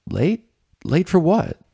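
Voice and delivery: high rising confused voice; puzzled question. A narrator's reading. Rainbow confused about being late, high rising question. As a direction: ask it baffled.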